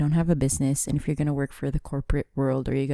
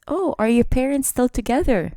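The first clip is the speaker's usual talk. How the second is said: mock nosy question; 8 semitones higher than usual. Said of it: "the next question is".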